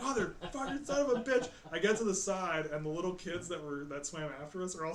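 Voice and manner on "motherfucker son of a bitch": high, loud cursing in pain; cussing. Retold or imitated. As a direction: swear it out at the top of your voice. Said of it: "fucking every other, every word out of my mouth".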